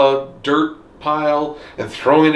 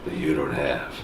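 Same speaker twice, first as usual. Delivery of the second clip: low, quiet tough-guy voice; gruff action hero; 11 semitones lower, 9 dB quieter than usual. Pretend. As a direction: growl it like an action star. Fast and Furious parody.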